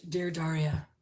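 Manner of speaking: soft breathy sultry whisper of a name. Pretend. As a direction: breathe it out like a phone seductress. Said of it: "I can do a breathy voice".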